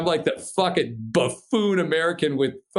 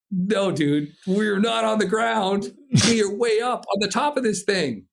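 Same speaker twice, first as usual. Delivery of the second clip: emphatic correction; 8 semitones higher than usual. Retold or imitated. Climber correcting the instructor over radio, high emphatic insistence. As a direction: spell it out to someone who isn't getting it.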